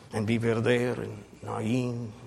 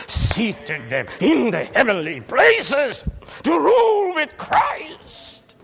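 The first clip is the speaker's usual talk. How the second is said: preaching shout; 13 semitones higher, 7 dB louder than usual. Real, not acted. Preaching climax.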